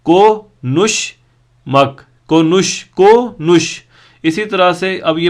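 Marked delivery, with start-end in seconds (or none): 0.0-1.1 "को नुश" slowly, as two separate sounds; split into syllables. Shown as a demonstration. Teacher showing learners two sounds, "को नुश" split slowly. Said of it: "इसमें दो sound बन रहे हैं". 2.3-3.8 "को नुश, को नुश" slowly, syllable by syllable; split into syllables. Shown as a demonstration. Teacher repeating for learners, "को नुश" in two slow beats. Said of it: "इसमें दो साउंड बन रहे हैं".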